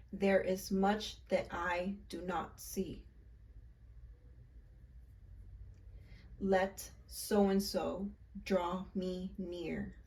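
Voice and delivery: slow, earnest recital of a spell; incantation recital. Shown as a demonstration. Leading viewers in the incantation, slow and earnest. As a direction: say each line as a solemn spell. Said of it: "So again".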